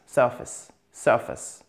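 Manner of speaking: "surface." stressed on SUR, weak ending; first-syllable stress. Shown as a demonstration. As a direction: punch the first syllable of "surface.", let the second drop. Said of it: "Accent tonique sur la première syllabe". (« surface. » accentué sur la première syllabe)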